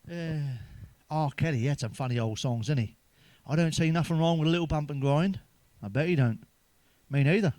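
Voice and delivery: normal talking voice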